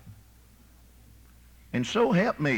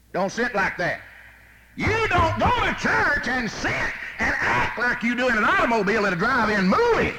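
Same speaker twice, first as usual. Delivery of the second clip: shouting rebuke; 9 semitones higher, 4 dB louder than usual. Real, not acted. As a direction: thunder it at the pews. Shouted rebuke of courting couples.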